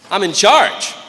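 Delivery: loud, high, defiant answer; defiant shout. Retold or imitated. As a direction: snap back with arrogant authority. Lazy squad leader's retorted answer.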